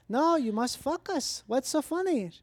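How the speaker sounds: put-on high, slow Mexican accent; puzzled professor imitated in a Mexican accent; innocently insistent, Mexican accent. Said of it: "my friend's wife's Mexican".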